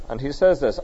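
ordinary talk, nothing marked

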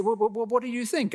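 high, quick stammered question; imitating a hesitant junior asking for feedback; nervous young preacher. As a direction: pluck up courage and blurt it out high. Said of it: "he plucked up courage".

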